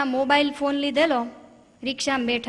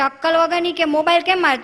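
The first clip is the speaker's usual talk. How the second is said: angry husband shout; 5 semitones higher, 6 dB louder than usual. Retold or imitated. Husband hit by thrown phone.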